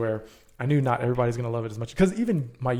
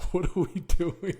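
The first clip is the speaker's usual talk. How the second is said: incredulous; 6 semitones higher than usual. Retold or imitated. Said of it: "I'm like".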